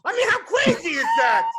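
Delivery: exasperated outburst. Real, not acted. Outraged comic punchline.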